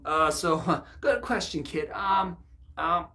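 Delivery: high, loud nervous laugh; a father stalling his son with a loud nervous laugh; flustered laugh. Retold or imitated. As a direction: laugh off the question awkwardly. Flustered father dodges child's question.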